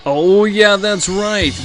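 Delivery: normal talking voice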